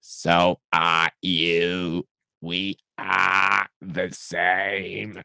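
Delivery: slow, grating Dalek drone. Acted. Dalek answers the Doctor in a slow, grating drone. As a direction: drag it out like a lonely Dalek.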